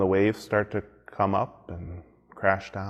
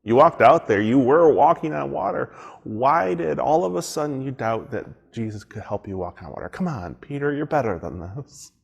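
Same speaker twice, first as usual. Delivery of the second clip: higher, louder mock scolding; scolding Peter; 5 semitones higher, 6 dB louder than usual. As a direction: chide him like a disappointed teacher. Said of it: "give Peter a hard time".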